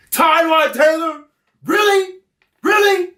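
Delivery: high, slow, incredulous; hammed-up disbelieving yell; mock disbelief. Co-host repeats pick in disbelief.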